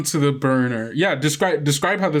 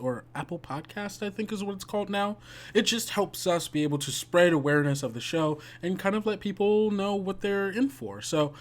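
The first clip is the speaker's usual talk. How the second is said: soft, quick host housekeeping read; host announcement; 8 dB quieter, faster than usual. Real, not acted. Game master asking listeners for reviews, soft and quick. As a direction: tell listeners the news, casual and fast.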